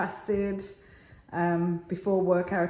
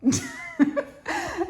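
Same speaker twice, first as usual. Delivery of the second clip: high-pitched burst of laughter; real laughter, pitched well up. Real, not acted. Vlogger cracking up on camera, high laugh.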